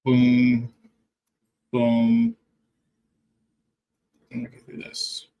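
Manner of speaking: ordinary talk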